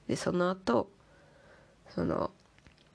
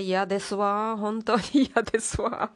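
exasperated; 4 semitones higher, 3 dB louder than usual. Real, not acted. Complaining about monthly periods.

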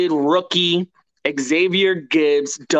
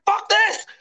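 much higher, strained cry of disbelief; wild celebration. Retold or imitated. Fan retelling his yell at the touchdown. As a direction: let it fly like the game just flipped. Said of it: "I'm like".

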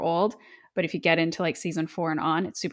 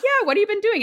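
high, louder chirpy small talk; mock cheery catch-up; 6 dB louder, faster than usual. Vamp and Buffy chatting, high and chirpy in mock cheer. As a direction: play it like old classmates catching up brightly. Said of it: "And they're like".

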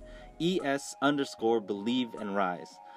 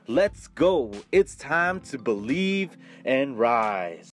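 podcast intro announcer; 4 dB louder than usual. Acted. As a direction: pump them up like an intro host. Hyped podcast intro over music.

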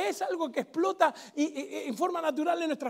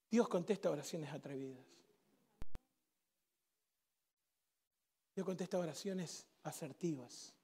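much softer, low, close-up sermon voice; intimate preaching; 11 semitones lower, 12 dB quieter than usual. Acted. Preacher speaking gently to the congregation about bold prayer, soft and low. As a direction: draw them in quietly.

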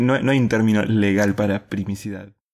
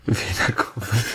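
laughing repeat; 4 dB quieter than usual. Real, not acted. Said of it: "Uy, pará".

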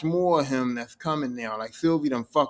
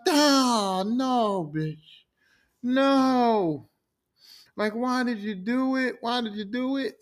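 higher, slower why did you do it; distressed Loki; 6 semitones higher, much slower than usual. Acted. Loki to Sylvie after the killing, higher dismayed disbelief. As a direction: ask it in disbelief, drawn out.

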